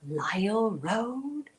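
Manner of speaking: mock narrator emphasis on "Lyle Road"; lightly mimicked narrator reading; imitating audiobook narrator. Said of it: "Why are you talking like that?".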